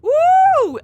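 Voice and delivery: high, loud woo; long, high-pitched cheer; excited whoop. Real, not acted. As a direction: let out a big celebratory whoop. Cheering a hit in game.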